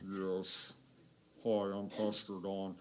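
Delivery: very deep, hushed man's voice; Pastor Don. Retold or imitated. Preacher imitating his pastor's introduction, deep voice. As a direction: drop right down low and soft. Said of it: "he has this deep voice".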